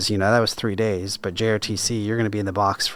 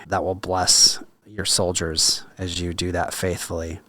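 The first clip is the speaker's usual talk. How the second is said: usual speaking voice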